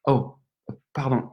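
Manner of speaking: ordinary talk, nothing marked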